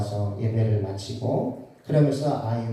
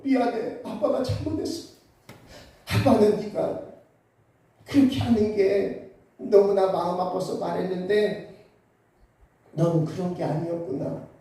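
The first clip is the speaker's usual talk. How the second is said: tearful; 6 semitones higher than usual. Real, not acted. Father recalls crying with his son.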